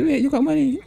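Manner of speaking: high, incredulous mock question; mock surprise. To a friend who claimed to be broke, high mock surprise. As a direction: play the startled friend catching them out.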